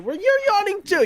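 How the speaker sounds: high, loud playful accusation; excited call-out. Real, not acted. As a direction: catch him out, loudly. Calling out co-caster's yawn.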